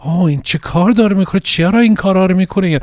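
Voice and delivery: high, quick voice of a worried Persian mother; fretting mother. Acted. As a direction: imitate a mum fussing in rapid Persian. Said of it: "might be like my mother going".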